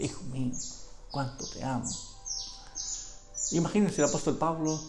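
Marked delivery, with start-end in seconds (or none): 0.0-1.9 softer, a father's loving murmur; tender father. Pretend. Preacher voicing a father kissing his son, tenderly softer. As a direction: say it like a hug to a son. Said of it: "como cuando un padre ... da un beso".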